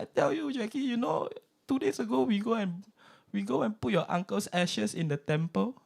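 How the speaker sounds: put-on high old auntie voice, confiding; godma voice. Godma confiding to Jackie about the ashes, high auntie voice. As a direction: lean in like an auntie sharing gossip.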